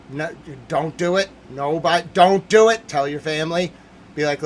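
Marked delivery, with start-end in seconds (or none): 2.1-2.9 loud warning. Real, not acted. Streamer yelling warning.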